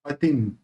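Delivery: पतिम ending on a half m, lips closed. Shown as a demonstration. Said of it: "पतिम का म आधा है".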